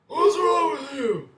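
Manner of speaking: hammed-up old lady shrieking a question; old women's voice. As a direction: imitate old women with cigars scolding. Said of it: "old shriveled up women".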